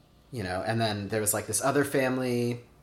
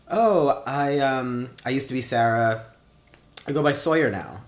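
nervously explaining yourself to a relative; awkward nervous explanation; 3 semitones higher, 5 dB louder than usual. Retold or imitated. Nervous self-introduction to uncle.

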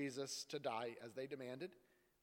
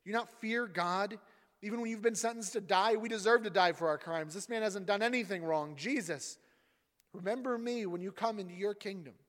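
higher, louder rebuke; protesting; 6 semitones higher, 7 dB louder than usual. Acted. Second criminal rebuking the first, loud protest. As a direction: scold the other thief, then plead. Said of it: "The other criminal protested".

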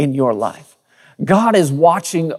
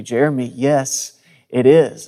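lower, measured, patient answer; patient mother; 3 semitones lower than usual. Retold or imitated. Mother answering her frustrated son, very patiently. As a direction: imitate a mom calmly insisting. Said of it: "she would just say very patiently".

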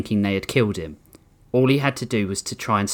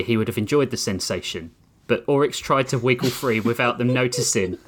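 usual speaking voice

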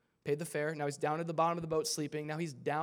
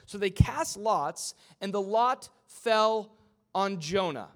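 raised, loud preacher's reading; forceful scripture reading; 5 semitones higher, 8 dB louder than usual. Acted. Preacher reading the verse to the church, forceful and dramatic. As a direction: punch out each phrase, stretching key words.

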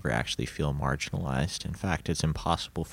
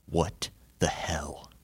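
imitated stunned friend; 4 dB louder, slower than usual. Co-host quotes a friend outside the basement, stunned disbelief. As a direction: start in a drawn-out whisper, build to amazed emphasis. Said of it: "Baylor goes".

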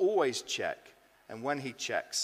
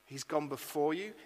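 ordinary talk

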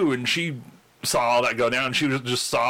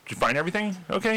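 quick, high-pitched store employee question; lightly voiced retail greeting, rattled off; higher, brisk customer service check-in; guest service patter; 8 semitones higher, faster than usual. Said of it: "the usual guest service thing".

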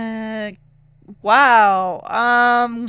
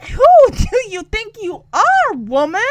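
much higher, louder, slowed challenge; Betty ready to fight; 7 semitones higher, 6 dB louder than usual. Retold or imitated. Betty squaring off with the snotty woman, loud and indignant. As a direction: hand on hip, square up to her. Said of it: "ready to knock your dang block off".

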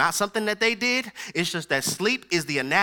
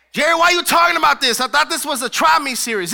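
imagined objection; 6 semitones higher, 8 dB louder than usual. Pretend. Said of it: "imagined listener objecting".

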